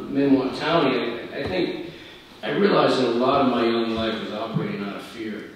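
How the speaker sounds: normal talking voice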